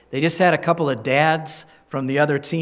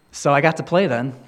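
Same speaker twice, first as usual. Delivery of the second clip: usual speaking voice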